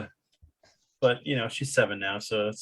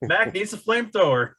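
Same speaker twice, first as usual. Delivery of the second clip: Mac needs a flamethrower repeated much higher and louder; 10 semitones higher, 6 dB louder than usual. Real, not acted. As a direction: say it again louder so they hear you.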